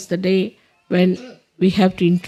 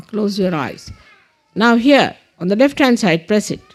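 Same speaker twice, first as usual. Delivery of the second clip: deliberate prayer leader with long pauses; measured meditation instructions with space to follow; guided meditation; 4 semitones higher than usual. Real, not acted. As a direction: guide them through it, phrase by phrase. Leads affirmations in guided meditation.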